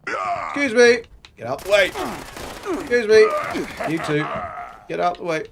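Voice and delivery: high, loud mock-impatient call. Gamer to characters blocking the way, calling out.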